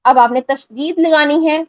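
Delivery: usual speaking voice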